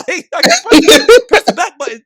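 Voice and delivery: high, laughing mock indignation; laughing protest. Acted protest to commenter, laughing.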